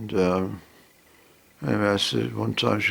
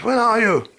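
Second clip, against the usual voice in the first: loud and higher, straining over a bad line; shouting down the phone; 13 semitones higher, 5 dB louder than usual. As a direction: shout to be heard on a faint call.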